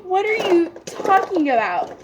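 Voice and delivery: high-pitched, incredulous question; voiced disbelief. Clerk baffled by customer's sad confession, high incredulous question. Repeats her baffled reaction.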